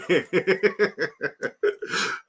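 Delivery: long high giggling fit; high-pitched run of laughter; cracking up. Real, not acted. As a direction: giggle helplessly. Cracking up at the mispronunciations.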